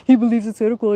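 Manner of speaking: ordinary talk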